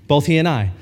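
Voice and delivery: usual speaking voice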